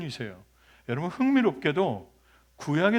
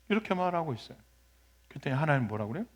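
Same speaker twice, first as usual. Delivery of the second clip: ordinary delivery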